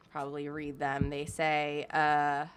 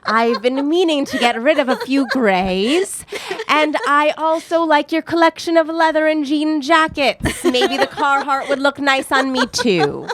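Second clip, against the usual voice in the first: creepy, slow, louder, higher killer voice; serial killer voice; 5 semitones higher, 9 dB louder than usual. Pretend. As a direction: leer it like a slasher killer. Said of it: "Serial killer vibes".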